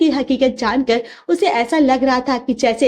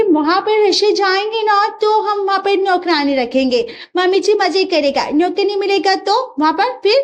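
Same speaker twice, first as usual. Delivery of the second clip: higher, sing-song broken Hindi; foreign bride voice; 7 semitones higher than usual. Acted. Russian bride teases her mother-in-law in broken Hindi.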